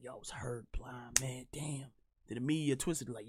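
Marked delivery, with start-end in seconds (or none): 0.0-1.9 mock whiny. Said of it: "playing the passive aggressive".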